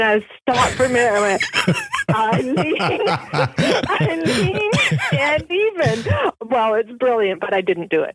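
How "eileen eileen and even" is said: slow "Eileen" through giggles, going high; laughing realisation. Real, not acted. Hearing her husband's pun and laughing as it dawns on her. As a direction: let the pun dawn on you, laughing.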